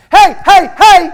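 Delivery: calling the audience back in. Real, not acted. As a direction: shout to grab attention. Calling the crowd to attention.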